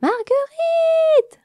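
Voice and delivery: very high, loud, drawn-out calls; frantic calling. Acted. Dame Églantine running from room to room, calling the missing queen. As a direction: call her name through the hive, frantic. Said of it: "répéta Églantine".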